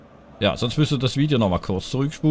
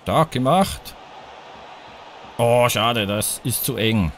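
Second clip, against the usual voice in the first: normal talking voice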